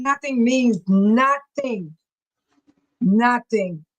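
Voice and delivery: NOTHING drawn out slowly and deliberately; stress on NOTHING. Real, not acted. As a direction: hammer home each "nothing", stretching it out. Driving home a quoted scripture word.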